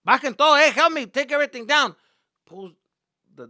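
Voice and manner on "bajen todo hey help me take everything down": loud, high-pitched orders; dad yelling. Retold or imitated. Dad telling the family to unload, yelling. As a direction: yell instructions at the family to unload. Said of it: "my dad is yelling us".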